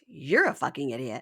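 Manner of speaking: amused parroting of an insult; mocking echo. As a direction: throw the insult straight back, close to laughing. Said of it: "It's all he does is repeat it back".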